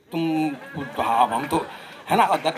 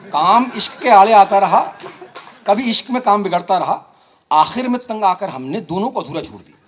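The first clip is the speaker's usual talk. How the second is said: emphatic, louder mushaira recitation; poetry recital; 9 dB louder than usual. Acted. Poet closing Faiz's poem for an audience, declaiming. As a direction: declaim the couplet out to the crowd.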